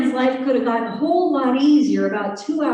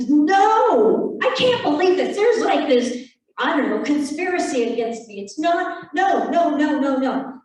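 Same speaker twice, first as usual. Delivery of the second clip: mock outraged rant; 3 semitones higher than usual. Retelling the stubborn customer's rant at the teller, hammed-up outrage. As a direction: whine about a conspiracy against him.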